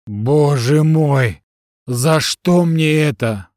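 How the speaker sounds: very slow, drawn-out lament; anguished exclamation. A narrator's reading. Husband appealing to heaven over his wife, anguished lament. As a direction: cry to heaven, stretching every word. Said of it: "восклицал Олег Николаевич, воздевая руки к небу".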